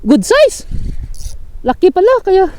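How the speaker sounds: very high, loud thrilled shouts; excited shouting. Real, not acted. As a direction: cheer the big catch. Landing a big fish.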